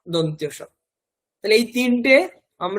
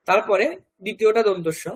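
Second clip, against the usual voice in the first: normal talking voice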